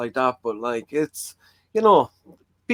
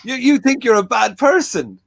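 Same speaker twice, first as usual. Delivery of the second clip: mock public-warning advert voice, much higher and louder; Robin Ince warning ad; 9 semitones higher, 4 dB louder than usual. Guest mocking a podcast guest's delivery as a warning ad. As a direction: ham it up like a TV warning commercial. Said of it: "the way he said it".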